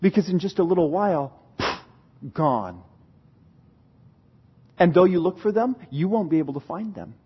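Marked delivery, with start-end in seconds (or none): none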